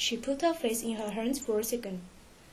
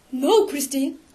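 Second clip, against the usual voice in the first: raised-voice protest; crying out; 3 semitones higher, 6 dB louder than usual. A narrator's reading. Raoul protesting to Christine at their last meeting, crying out. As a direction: cry out in mild alarm. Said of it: "Raoul cried".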